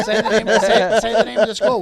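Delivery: high, loud teasing demand. Real, not acted. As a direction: push them to say it, laughing.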